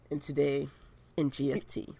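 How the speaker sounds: low, soft attempt at a deep narrator; Morgan Freeman. Co-host trying out a narrator's voice for the show, low Morgan Freeman imitation. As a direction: drop your voice into a mock movie-narrator register. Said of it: "we would do Morgan Freeman voice".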